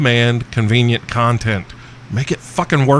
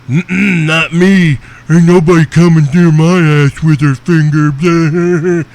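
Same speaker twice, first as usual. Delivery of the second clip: loud, higher mocking voice, drawn out; whiny sensitive dude; 5 semitones higher, 7 dB louder, slower than usual. Host mocking squeamish male listeners, loud sensitive-dude voice. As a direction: imitate an oversensitive guy, loud and slow. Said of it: "sensitive ass fucking dudes listening to this going".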